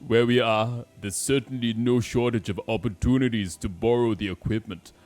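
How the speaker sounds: forceful put-on accent; accented game character.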